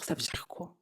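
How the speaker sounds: a soft, whispered, worried question. Real, not acted. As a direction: ask it under your breath, shaken. Panicked thought about the parents. (chuchoté, inquiète)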